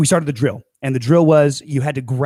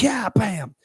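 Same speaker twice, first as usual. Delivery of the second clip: high punchy bam; 8 semitones higher, 3 dB quieter than usual. Retold or imitated. Punching bravado.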